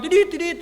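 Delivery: high mock horn beeps; horn imitation. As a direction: toot like an impatient rider's horn. Imitating a motorbike horn again.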